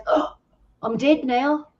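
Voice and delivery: high-pitched imitation of a man giving up; high mock panic. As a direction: play the panicked patient after a gasp. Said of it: "his voice is a bit high".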